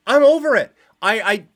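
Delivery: loud, high exasperated cry of I'm over it; exasperated outburst. Real, not acted. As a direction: burst out, fed up at last. Exasperated rant about Conor.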